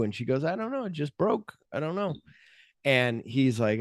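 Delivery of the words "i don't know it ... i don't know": high, softer playing-dumb voice; mock innocence. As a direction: play dumb, small and high. Acted innocent prisoner to officer.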